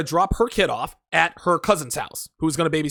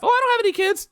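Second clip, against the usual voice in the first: put-on much higher, airy girlish voice; mock woman's reply; faster than usual. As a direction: play her innocent answer.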